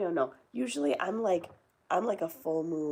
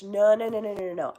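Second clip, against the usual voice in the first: loud emphatic no; 4 semitones higher, 10 dB louder than usual. Real, not acted. Reacting to a viewer killing plants.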